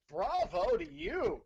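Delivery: high, slow mock applause; slow sarcastic cheer; sarcastic bravo. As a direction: drip sarcasm as you praise him. Sarcastic praise for commissioner.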